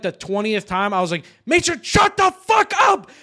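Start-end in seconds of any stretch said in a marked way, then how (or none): 1.4-3.1 high, loud furious outburst, reenacted; exasperated yell. Player snapping at a downed teammate mid-fight, exasperated yell. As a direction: blow up at a nagging teammate.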